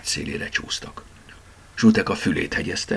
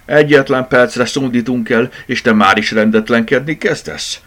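louder, higher indignant grumble; grumbling complaint; 6 semitones higher, 7 dB louder than usual. A narrator's reading. Berta woken from a nap by the rabbit, grumbling. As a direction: scold the troublemaker. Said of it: "brummogta Berta".

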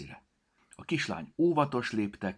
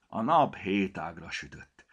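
ordinary delivery, nothing marked

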